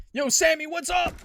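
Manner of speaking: very high, loud hype hello; excited greeting. Real, not acted. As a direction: shout out a friend, pumped. Greeting a viewer.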